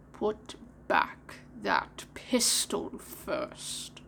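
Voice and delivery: slow, menacing whisper; threatening whisper. Acted. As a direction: hiss it out as a threat. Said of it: "it said threateningly".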